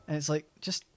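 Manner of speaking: ordinary talk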